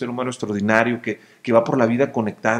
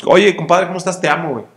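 hearty buddy greeting; 5 semitones higher, 8 dB louder, faster than usual. Retold or imitated. Voicing a friend greeting his buddy.

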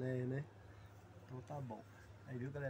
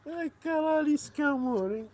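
loud, high outburst; loud, swamped by affection; overwhelmed exclamation; 7 semitones higher, 9 dB louder than usual. Real, not acted. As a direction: cry out under a pet's onslaught of love.

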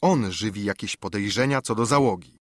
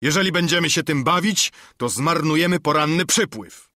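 higher, louder voice of protest; Długi John yelling; 5 semitones higher, 4 dB louder than usual. A narrator's reading. Cook protesting to the sailors about the tide, yelling. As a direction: raise your voice to object to the delay. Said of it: "wrzasnął Długi John".

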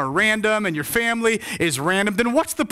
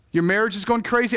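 ordinary talk, nothing marked

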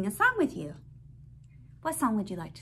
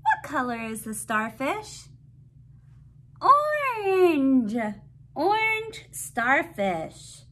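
bright, sing-song picture book reading; reading to a toddler; 8 semitones higher, 5 dB louder, slower than usual. Acted. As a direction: read it out like to a little one.

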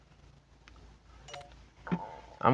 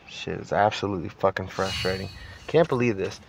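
ordinary delivery

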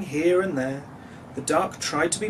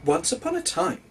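high, sing-song storyteller mother; mother bus storytelling; 13 semitones higher than usual. A narrator's reading. Mother bus starts a bedtime story, high and sing-song. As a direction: begin like a mother telling a tale. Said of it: "the mother bus said".

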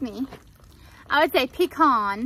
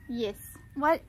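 ordinary delivery

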